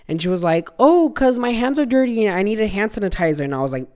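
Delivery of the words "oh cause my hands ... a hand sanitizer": higher-pitched imitation of mom; lightly voiced mom. Mom explains herself to her child, lightly voiced in higher pitch. Retelling mom's innocent explanation.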